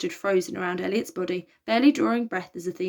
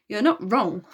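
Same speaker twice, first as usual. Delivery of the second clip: ordinary delivery, nothing marked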